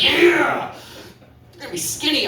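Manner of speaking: high flirty lady voice; horny lady voice. Acted. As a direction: purr it, playfully flirty.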